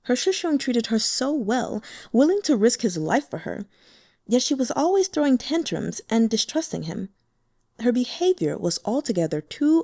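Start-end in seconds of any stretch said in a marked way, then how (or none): none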